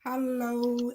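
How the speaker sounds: normal talking voice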